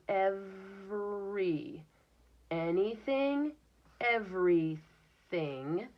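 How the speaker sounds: "Every" drawn out slowly, then "everything" blended; teacher sounding out "everything" for kids; slow word building. Shown as a demonstration. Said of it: "Get your mouth ready".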